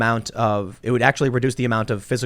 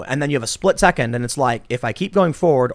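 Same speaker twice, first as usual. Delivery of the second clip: ordinary delivery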